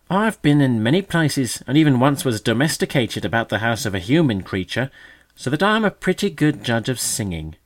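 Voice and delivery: loud, high showy magpie voice; a puffed-up old magpie showing off; boastful magpie. A narrator's reading.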